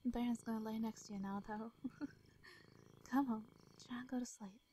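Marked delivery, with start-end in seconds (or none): none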